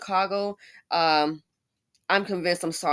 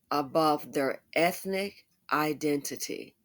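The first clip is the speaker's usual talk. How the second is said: slow, deliberate reading; slower than usual. Shown as a demonstration. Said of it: "We have some doubters in the house".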